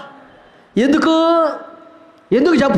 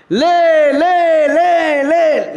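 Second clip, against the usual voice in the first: calling to get up; 6 semitones higher, 3 dB louder, much slower than usual. Retold or imitated. Urging a lazy person up.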